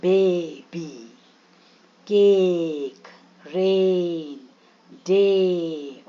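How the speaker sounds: बेबी, केक, रेन, डे with the ए held long and falling; drawn-out long A. Shown as a demonstration. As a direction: slowly stretch the vowel in केक, रेन, डे. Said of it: "लंबा खींचे".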